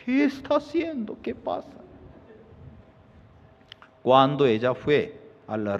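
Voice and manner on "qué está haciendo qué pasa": high, hushed, fading to a whisper; frightened wife. Acted. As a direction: ask in startled fear. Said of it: "ella estaba asustada".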